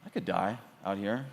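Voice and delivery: dropped low and near-whispered, stunned; a quiet, frightened aside to yourself; hushed inner thought. Retold or imitated. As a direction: say it under your breath like a sudden realisation. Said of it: "I just thought".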